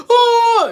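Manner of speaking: long, very loud high-pitched howl; exaggerated scream of pain; imitated pained wail. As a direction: mimic a gut-punched kid crying out. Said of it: "the kid is still like".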